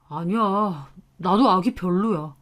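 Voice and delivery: low, soft, half-hearted denial; subdued, noncommittal son's voice; vague, reluctant husband. A narrator's reading. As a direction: give in reluctantly, without conviction. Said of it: "엄청 두루뭉술하게 마지못해".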